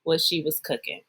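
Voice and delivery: low, measured play-by-play for listeners; voice commentator. Pretend. Cohost narrating a Rock impression for listeners, low commentator voice. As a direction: describe the pose like a deadpan commentator, low and deliberate. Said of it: "giving the voice commentator for the listeners".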